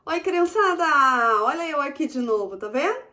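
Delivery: put-on high, loud excited kids' greeting; bright squeaky sing-song welcome. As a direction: greet the kids with big excitement. Greeting children in new segment. (saudação animada e aguda para crianças)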